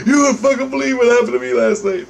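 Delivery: high-pitched, excited imitation of a friend; astonished retelling. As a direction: burst out with unbelievable news. Acting friend bursting with news.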